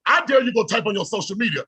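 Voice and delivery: ordinary talk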